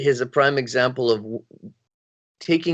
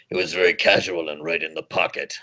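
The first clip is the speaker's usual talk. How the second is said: put-on hungover college kid voice; 8 semitones lower than usual. Shown as a demonstration. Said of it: "my hungover college kid voice".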